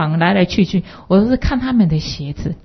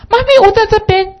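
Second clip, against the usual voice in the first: put-on high, louder child's call; 13 semitones higher, 6 dB louder than usual. Lost boy calling back to his mother, high and loud. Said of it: "那这个小孩子走过来".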